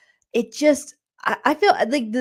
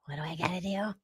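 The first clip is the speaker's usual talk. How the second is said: soft timid question; 5 semitones lower, 13 dB quieter, faster than usual. Retold or imitated. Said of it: "Little Louisiana Lauren's like".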